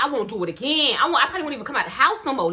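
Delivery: ordinary talk, nothing marked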